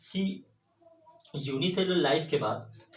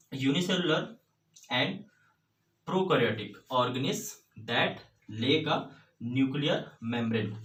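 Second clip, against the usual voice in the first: words drawn out very slowly, one by one; slow dictation; much slower than usual. Shown as a demonstration. Teacher dictating the textbook definition of Monera, very slow. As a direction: read it slowly so the class can write.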